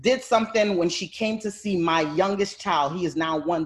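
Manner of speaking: ordinary talk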